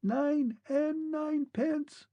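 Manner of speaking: a tiny clear voice from afar; the last distant words, thin and distinct. A narrator's reading. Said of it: "very thin and distinct".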